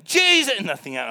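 very loud, very high cry; urgent commanding shout. Retold or imitated. Preacher retells third try on lifeless boy, urgent commanding shout. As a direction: bellow the name high, at full force.

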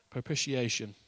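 normal talking voice

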